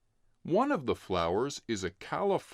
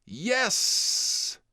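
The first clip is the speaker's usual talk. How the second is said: high bright yell of joy; excited yes; 9 semitones higher, 4 dB louder than usual. Acted. Brother agreeing to the bike ride, high happy shout. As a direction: jump on it like an eager kid.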